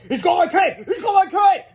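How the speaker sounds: high, loud frantic shout; panicked cry. A narrator's reading. Superhero grabbed by his cape, shouting in panic. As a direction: wail it in cartoon panic. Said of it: "cried Counter Defense".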